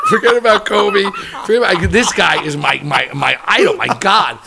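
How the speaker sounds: loud, laughing exclamation; real laughter while raving about his idol; laughing loudly. Real, not acted. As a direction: shout it through real laughter, thrilled. Excited story climax, laughing.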